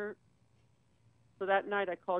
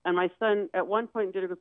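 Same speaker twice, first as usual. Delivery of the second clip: ordinary talk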